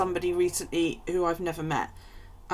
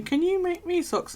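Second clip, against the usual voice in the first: pleading asker; 12 semitones higher, 3 dB louder than usual. Retold or imitated. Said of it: "when people say to me".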